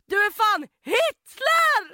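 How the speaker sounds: high, slow yelled insult; shouting at the bus driver who refused her; retold shout. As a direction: yell it at the driver. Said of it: "då skrek jag".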